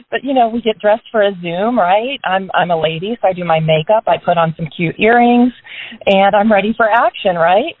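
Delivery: ordinary talk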